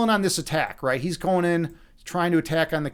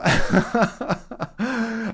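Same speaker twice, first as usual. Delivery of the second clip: real burst of laughter; laughing; 4 semitones higher than usual. Real, not acted. Storyteller amused by his own frog voice, real laugh. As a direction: break off into a chuckling laugh.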